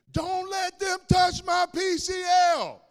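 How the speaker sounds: drawn-out, high bellow at full volume; drugged patient hollering down a hallway; yelling full-out. Retold or imitated. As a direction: yell it slowly at the top of your lungs. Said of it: "I was yelling at the top of my lungs".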